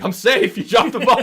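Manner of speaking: high, loud gleeful shout; excited protest. Retold or imitated. Runner to the ref after the collision, excited shout. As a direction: call it triumphantly to the ump. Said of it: "I'm like".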